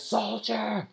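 high-pitched summons, drawn out; calling out. Acted. General to his soldier, calling out. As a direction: call a soldier over like a general.

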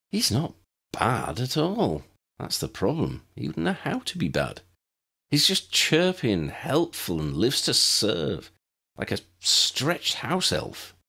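put-on weary teenage girl listing his faults; exasperated Tessa, explaining. A narrator's reading. Tessa explains to Lydia why Eric bores her, exasperated.

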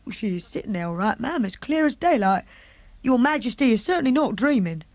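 lightly voiced housemaid, earnest; the maid. Maid assuring the Queen she is awake, lightly voiced.

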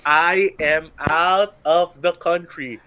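slow, loud word-by-word announcement; emphatic auto-reply. Acted. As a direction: spell it out slowly and loudly. Voices an out-of-office reply.

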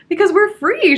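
high, loud, chipper cheer; imitating a chipper girl; enthusiastic. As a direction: burst out with mock glee. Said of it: "she says enthusiastically".

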